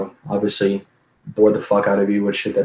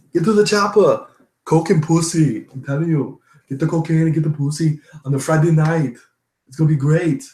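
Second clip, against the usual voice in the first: higher Austrian action-hero voice; mock an Austrian strongman giving orders; hammed-up Austrian accent, stretched stressed words; Arnold; 5 semitones higher than usual. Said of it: "I can do Arnold all day".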